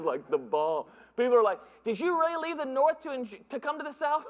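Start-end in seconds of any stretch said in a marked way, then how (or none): 1.8-4.2 mock incredulous local. People questioning his move south.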